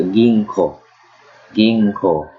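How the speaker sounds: ginko with a long final o; emphasized O. Shown as a demonstration. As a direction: lean on the o ending ginko. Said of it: "kinda emphasize the O".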